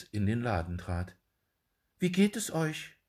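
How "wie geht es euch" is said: high, polite little dwarf. A narrator's reading. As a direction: greet him in a high small voice. Dwarf asks the shoemaker. (hohe Zwergenstimme)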